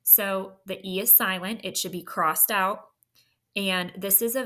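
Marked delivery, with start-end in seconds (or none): none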